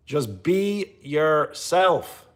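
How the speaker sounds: very slow, drawn-out words; emphatic plea. Real, not acted. Streamer telling a repetitive viewer to stop, slow and emphatic. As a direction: spell it out word by word. Exasperated repeat of advice.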